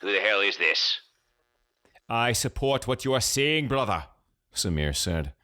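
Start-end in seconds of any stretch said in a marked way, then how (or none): 0.0-1.0 lightly voiced nasal old man; a hint of a suspicious old crank. A narrator's reading. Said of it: "The nasally old man". 2.1-4.1 thicker mock Pakistani accent, higher pitch; deepened fake Pakistani accent. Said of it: "deepening the fake accent".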